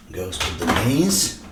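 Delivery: usual speaking voice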